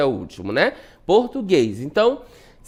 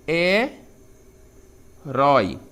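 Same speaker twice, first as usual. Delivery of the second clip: herói pulled apart into he and rói with a long pause; very slow, two separate syllables; 4 semitones lower, much slower than usual. Shown as a demonstration. Teacher separating herói for the class, syllable by syllable.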